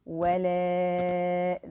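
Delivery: ولا held very slowly for four counts; slow counted elongation; four-count prolonging. Shown as a demonstration. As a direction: stretch ولا long. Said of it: "prolonging for four counts".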